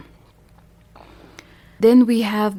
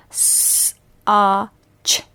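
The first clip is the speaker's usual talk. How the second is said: very slow, sounds of s-a-ch stretched apart; slow sounding out. Shown as a demonstration. Teacher slowly sounding out a word. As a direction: draw out s-a-ch sound by sound.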